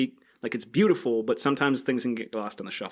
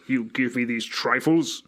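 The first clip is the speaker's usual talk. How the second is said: Hades. Acted. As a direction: play the hammed-up dark lord receiving trifles. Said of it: "With, like, the deepest voice ever".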